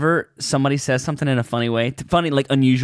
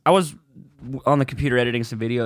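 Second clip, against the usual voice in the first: ordinary talk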